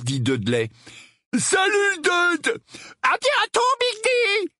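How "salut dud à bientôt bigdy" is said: put-on very high boyish holler; shouted goodbyes. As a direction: yell across the street to your mates. Gang boys shout their goodbyes. (cris d'au revoir très aigus entre copains)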